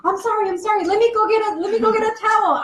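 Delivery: high, hurried imitation of a flustered receptionist; mock-panicked front desk lady, voiced high; panicking nurse. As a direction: fuss and apologize all at once. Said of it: "then she starts panicking".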